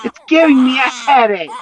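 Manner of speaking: put-on high, loud furious boy; angry shout. A narrator's reading. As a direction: yell back at mom. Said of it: "said Sammy angrily".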